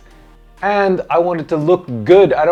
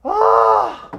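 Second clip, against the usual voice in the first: relieved shout. Real, not acted. Relief after near miss.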